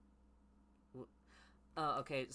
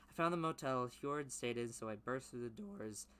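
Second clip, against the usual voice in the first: ordinary talk, nothing marked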